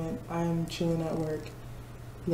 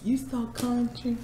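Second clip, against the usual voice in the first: high, slow Southern drawl; mock Southern drawl; 7 semitones higher, slower than usual. Nashville locals teasing her, slow Southern drawl. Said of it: "they're, like, always like".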